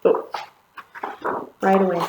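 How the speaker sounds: plain reading voice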